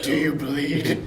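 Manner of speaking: slow, gruff Batman growl. Retold or imitated. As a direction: drag it out like Batman. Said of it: "Ben Affleck's Batman voice".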